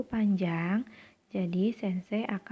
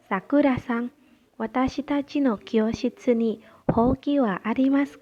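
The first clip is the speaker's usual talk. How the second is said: louder, higher model reading; raised, projected classroom voice; projected dialogue reading; 4 semitones higher, 7 dB louder than usual. Shown as a demonstration. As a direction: read the Japanese line out clearly to a class.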